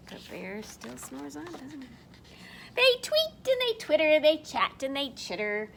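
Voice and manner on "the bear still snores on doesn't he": whispered-soft storybook hush. Acted. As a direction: hush so the bear stays asleep. Hushed refrain, bear sleeping.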